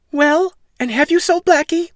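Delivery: high, loud scolding mother; worried mother. Acted. Mother complaining to Jack about the cow, high and loud.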